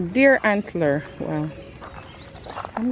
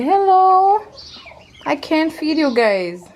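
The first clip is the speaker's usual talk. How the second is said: high, sweet voice for the chickens; talking to animals; 9 semitones higher, 6 dB louder, slower than usual. Real, not acted. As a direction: coo at the birds.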